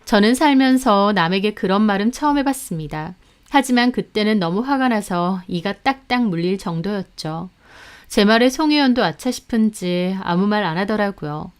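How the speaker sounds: usual speaking voice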